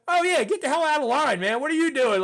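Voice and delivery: loud, high mock bark; joking scolding. Sergeant pulling graduate out of line, funny loud scolding. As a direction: imitate a sergeant ordering with amused exasperation. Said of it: "he was, like, funny about it".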